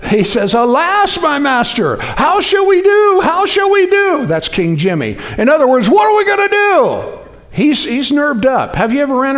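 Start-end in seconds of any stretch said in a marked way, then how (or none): 0.5-4.3 high, anxious servant's cry; frightened servant. Acted. Servant panicking to his master about the army, high and anxious. 5.9-7.2 high, worried plea; panicked servant. Acted. Servant asking Elisha what to do, panicky and high.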